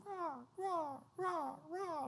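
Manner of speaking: high thin bird cries, rah rah; imitating bird calls, very high; keyboard bird noises. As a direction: squawk like birds, high and soft. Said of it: "start going".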